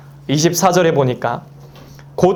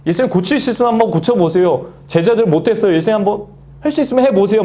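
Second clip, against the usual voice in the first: higher, fast, half-daring dare to Jesus; doubtful challenge; 6 semitones higher, faster than usual. Retold or imitated. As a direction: toss it off like a skeptic testing him. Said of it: "이런, 어, 뉘앙스로 아버지가 얘기를 한 거죠". (반신반의하며 빠르게 도전하듯)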